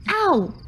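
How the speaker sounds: quick yelp of pain; sharp pained ow. Acted. Cactus thorn in arm.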